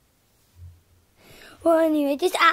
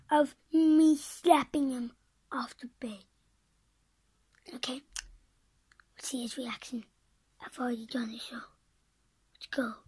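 whispering; 3 semitones lower, 8 dB quieter, faster than usual. Real, not acted. Whispering near sleeping brother.

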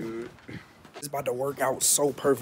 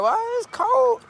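mock high whine; 5 dB louder, slower than usual. Mocking grandma's cold complaint.

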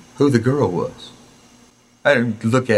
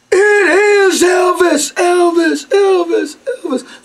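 much higher, loud, slow shrieking of the name; excited screaming; 6 dB louder, much slower than usual. Retold or imitated. Mother spotting [name] in the drugstore, screaming excitedly. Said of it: "The whole store heard her".

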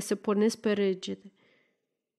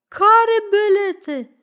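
high, drawn-out, louder wail; crazed yell; 10 semitones higher, 5 dB louder, much slower than usual. A narrator's reading. Accused woman shouting back at Lefter, crazed yell. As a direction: screech it slowly like a madwoman. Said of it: "zbiară și chivuța ca o nebună".

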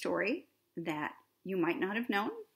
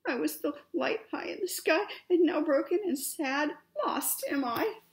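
high, halting lament; mock-tearful storybook voice; sniffly and sad; 7 semitones higher, 4 dB louder than usual. A narrator's reading. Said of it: "Luna sniffed".

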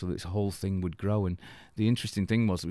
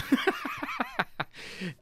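high, breaking laughter; hearty laugh; 13 semitones higher than usual. Real, not acted. Laughing at a bandmate's dig, amused. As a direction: burst out laughing after the punchline.

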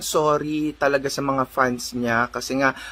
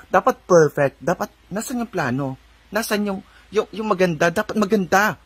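higher-pitched, insistent mock demand; demanding mock questioning; 5 semitones higher than usual. Telling the dilawan what they should say, demanding. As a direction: act out demanding to know, pitched up. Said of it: "Di ba dapat sasabihin nyo".

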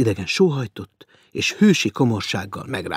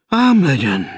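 mournful; 4 semitones higher, 7 dB louder than usual. A narrator's reading. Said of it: "felelte búsan".

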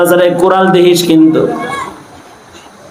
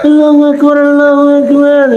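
azan-style call; 8 semitones higher than usual. Shown as a demonstration. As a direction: call it like the muezzin. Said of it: "এরকম করে জোরে কয়". (আজানের মতো উঁচু গলায় ডাক)

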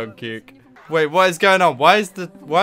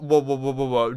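normal talking voice

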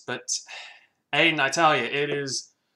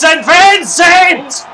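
big, slow, much higher and louder shout of the name; excited goal call; 11 semitones higher, 13 dB louder, much slower than usual. Real, not acted. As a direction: bellow it as the ball sails through. Commentator calls a great goal.

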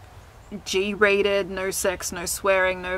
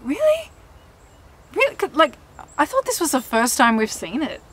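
mock surprise; 7 semitones higher than usual. Acts own feigned surprise at complaint.

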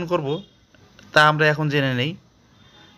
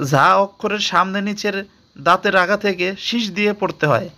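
ordinary talk

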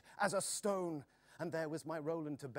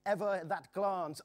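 plain reading voice

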